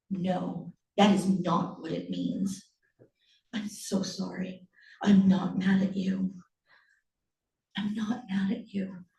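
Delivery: put-on calm the child, nearly whispering in places; hushed, remorseful soothing; tender reassurance. Mother gently reassures upset son.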